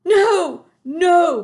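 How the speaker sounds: very high, loud panicky cry; frantic denial. A narrator's reading. BFG desperately denies hiding Sophie. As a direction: deny it in panic. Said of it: "cried the B F G".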